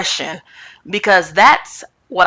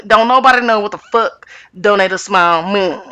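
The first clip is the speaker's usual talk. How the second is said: ordinary delivery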